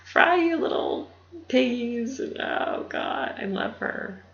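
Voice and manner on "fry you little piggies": higher, louder, slowed mock taunt; mean cheerleader hazing. Darla taunting the freshmen in a mock-mean, drawn-out voice. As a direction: sneer it like a mean upperclassman.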